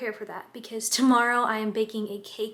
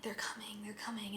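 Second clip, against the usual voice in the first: whisper; 13 dB quieter than usual. Retold or imitated.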